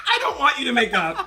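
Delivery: high, loud words through laughter; laughing protest. Real, not acted. Enjoying friends' feud, laughing loudly. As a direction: laugh hard while pushing the line out.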